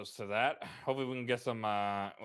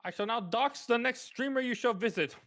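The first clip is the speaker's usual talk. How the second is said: high, theatrical villain announcement; mock grand proclamation; 12 semitones higher than usual. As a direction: declare it like a pompous villain. Mock grand declaration to streamer.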